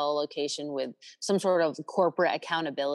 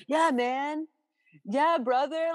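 higher, laid-back dude voice; frat bro; 7 semitones higher, slower than usual. Retold or imitated. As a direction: play the chill bro buddy. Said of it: "I go into my bro voice".

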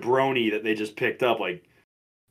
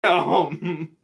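stunned; 5 semitones higher than usual. Retold or imitated. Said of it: "we were all just sitting there like".

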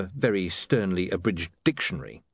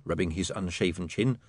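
plain reading voice

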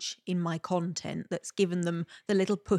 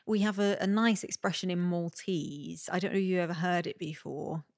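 ordinary talk

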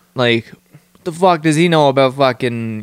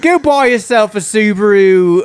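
higher-pitched attempt at an Aussie narrator; Australian accent; 8 semitones higher, 4 dB louder than usual. Mimicking car commercials for laughs, attempted Australian accent. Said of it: "like, Australian guy fucking narrating it".

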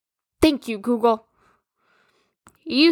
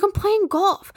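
higher, breezy boyfriend imitation; mock boyfriend voice; 9 semitones higher than usual. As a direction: mimic him like it's obvious. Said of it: "He's like".

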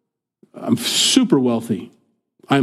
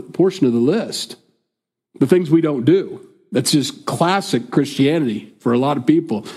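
usual speaking voice

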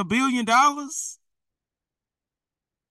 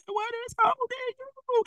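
high, sustained plea as God's voice; 6 dB quieter than usual. Acted. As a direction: draw the question out high, as if God asks it. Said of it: "God said".

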